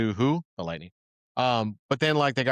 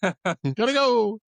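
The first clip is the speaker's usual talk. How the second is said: slow mock sign-off; 9 semitones higher, much slower than usual.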